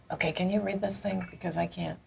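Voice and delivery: hushed whisper, dropping to breath; whispered plea. Retold or imitated. Playwright retelling asking her friend to read the review, whispered. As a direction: whisper it as if afraid of the answer.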